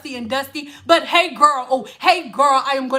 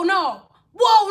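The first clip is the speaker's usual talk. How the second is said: usual speaking voice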